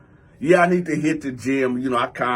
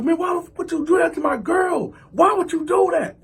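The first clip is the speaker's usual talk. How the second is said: high, forceful, wounded protest; mock outrage; 11 semitones higher than usual. Cousin keeps demanding about his girl, high outraged shout. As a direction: imitate an incensed cousin, voice way up.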